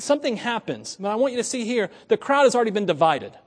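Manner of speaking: ordinary delivery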